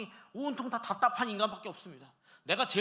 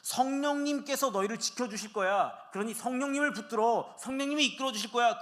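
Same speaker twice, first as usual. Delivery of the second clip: Jesus reassuring; 6 semitones higher, 6 dB louder than usual. Acted. Jesus comforting the disciples.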